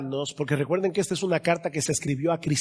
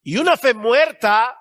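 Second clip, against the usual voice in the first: high, ringing sermon voice; emphatic preaching; 7 semitones higher, 6 dB louder than usual. Real, not acted. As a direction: hammer the warning home to the congregation.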